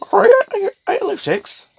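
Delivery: goofy falsetto mock quote; high squeaky voice. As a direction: chirp it up high like a cartoon kid. Mock voice of a chip eater.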